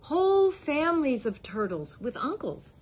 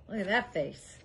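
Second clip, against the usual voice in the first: plain reading voice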